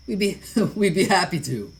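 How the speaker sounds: loud, laughing through it; cheerful chuckling agreement; laughing warmly, loud; laughing reply. Acted. [name] laughs while answering.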